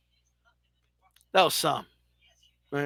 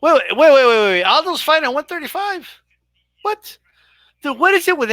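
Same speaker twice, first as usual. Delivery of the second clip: urgent repeated wait, then a much higher yelped what; stunned disbelief; 6 semitones higher, 3 dB louder than usual. Real, not acted. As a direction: caught off guard by shocking news, voice jumping up. Shocked by Aldo's weight class.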